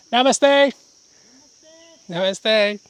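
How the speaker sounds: loud high calling greeting. Real, not acted. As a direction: call it out to someone far off.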